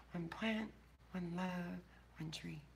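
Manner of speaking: hushed closing mantra; fading whisper sign-off. Real, not acted. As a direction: let the goodbye fade into a whisper. Soft stream sign-off.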